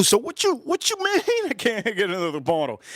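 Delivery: high, disbelieving question with a laugh; incredulous. Retold or imitated. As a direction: act shocked at the refusal. Arguing with the clerk, incredulous.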